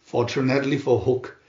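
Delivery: usual speaking voice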